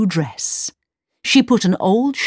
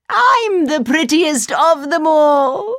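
higher, slow, gloating boast; evil queen; 8 semitones higher than usual. A narrator's reading. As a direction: crow with triumph. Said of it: "cried the wicked queen".

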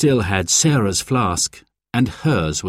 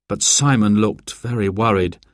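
ordinary delivery, nothing marked

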